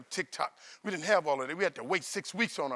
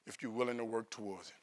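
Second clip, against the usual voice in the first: soft, low earnest advice; sincere mentor; 4 semitones lower, 5 dB quieter than usual. Retold or imitated. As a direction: speak it gently like a caring teacher. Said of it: "She said".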